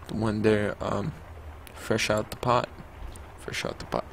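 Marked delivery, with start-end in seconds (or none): 3.4-4.1 teasing whisper, voiceless and soft; playful hushed repeat; mock whisper. Shown as a demonstration. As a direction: whisper it like a joke. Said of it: "I'm kidding".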